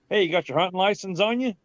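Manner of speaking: imitated warden checking up; game warden's challenge. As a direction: call out as the warden, fast and high. Acting the warden calling out.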